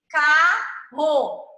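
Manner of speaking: "carro" loud, in two separate beats; loud, segmented "carro"; syllable by syllable. Shown as a demonstration. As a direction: split "carro" into ca and rro. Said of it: "colocar as sílabas aqui".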